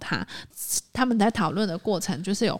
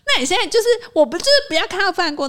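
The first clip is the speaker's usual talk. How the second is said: higher, louder, fast frustrated rant; exasperated quote; 10 semitones higher, 7 dB louder, much faster than usual. Retold or imitated. The client, driven crazy, repeats her demand in a fast exasperated rant. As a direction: spit out the complaint like someone driven mad. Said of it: "然后就也被他逼疯".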